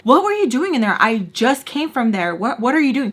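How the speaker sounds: loud, high confrontational questioning; really loud. Retold or imitated. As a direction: call him out, loud and accusing. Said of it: "Like, really loud".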